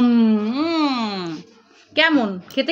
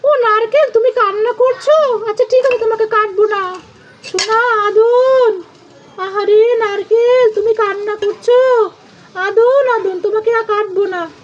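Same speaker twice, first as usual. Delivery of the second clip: put-on lightly high, louder soothing kid voice; comforting child; 8 semitones higher, 4 dB louder than usual. Child comforting the crying coconut, mock tender.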